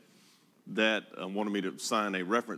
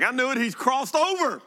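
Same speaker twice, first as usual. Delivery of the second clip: much higher, teasing "he's lost it" voice; mock neighbor voice; 13 semitones higher, 5 dB louder than usual. Pastor imitating neighbors who think he's crossed over, high teasing voice. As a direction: play the gossiping neighbor, pitched up high.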